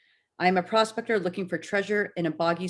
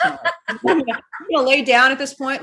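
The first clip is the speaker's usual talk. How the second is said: laughing; 7 semitones higher, 8 dB louder than usual. Real, not acted.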